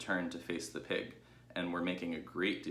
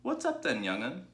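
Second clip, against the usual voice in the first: put-on much higher, croaky old sheep; Ma the old ewe croaking at the pig; 7 semitones higher, 4 dB louder than usual. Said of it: "she croaked".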